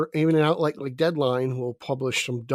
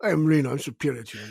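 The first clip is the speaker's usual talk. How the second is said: mock French Canadian caveman voice, lightly done; French Canadian accent; 2 semitones lower than usual. Friend parodies Reno's movie snobbery in French Canadian accent. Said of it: "Your French Canadian accent's horrible".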